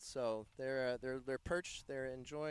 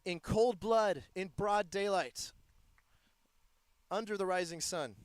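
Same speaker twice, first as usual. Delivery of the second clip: dramatic; 4 semitones higher, 5 dB louder than usual. Acted. Dramatic call of a tag.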